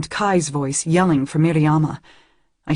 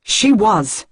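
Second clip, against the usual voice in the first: sharp, loud retort; barking back; 5 semitones higher, 6 dB louder than usual. A narrator's reading. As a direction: snap it back defensively. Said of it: "Miri barks back at him".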